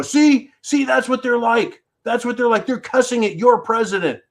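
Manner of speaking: high-pitched mocking finger-pointer, easing down at the end; mock accuser. Host voicing those who'd blame the crowd, mock accusing. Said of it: "So you could go".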